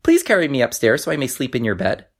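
high, small frog voice; squeaky storybook frog; little frog voice. A narrator's reading. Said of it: "he said".

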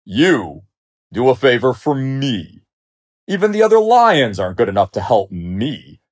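loud, incredulous mockery; scornful lion. A narrator's reading. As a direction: scoff at the mouse grandly. Lion mocks the mouse's offer.

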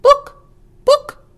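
very high loud clucks of book book; clucking hen. Acted. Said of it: "clucked the hen".

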